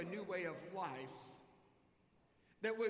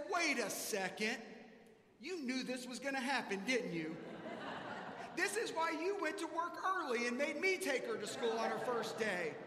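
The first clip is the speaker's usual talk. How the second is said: louder, higher mock accusation; playful accusation; 6 semitones higher, 5 dB louder than usual. Husband realizing his wife set him up, louder and mock-indignant. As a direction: catch her out, mock-indignant.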